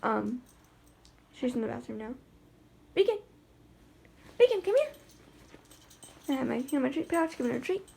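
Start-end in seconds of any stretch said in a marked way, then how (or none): 2.9-4.9 high, loud call across the room; calling the dog. Real, not acted. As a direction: call her back, bright and urgent.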